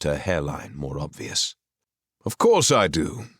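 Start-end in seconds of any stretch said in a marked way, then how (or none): 2.2-3.3 breezy, confident and upbeat; a cheerful, carefree answer; cheerily. Acted. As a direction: brush off the doubt with good cheer. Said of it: "Arthur said cheerily".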